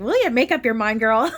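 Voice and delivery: teasing sassy friend, laughing. Retold or imitated. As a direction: playfully scold her, chuckling. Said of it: "They're like".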